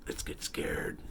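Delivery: breathy spooky whisper. Acted. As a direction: whisper the promise eerily. Spooky sign-off.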